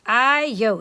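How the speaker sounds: loud weary exclamation; loud sighing exclaim. Acted. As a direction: blurt it out loud. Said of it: "exclaimed Papa".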